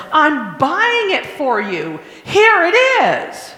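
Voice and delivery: high, slow mock mom, rising to loud; imitating a mom who gives in, sing-song; a hammed-up mom handing over the toy; giving-in mom. Mom caving to screaming child.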